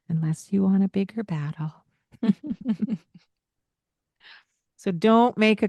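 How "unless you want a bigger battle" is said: normal talking voice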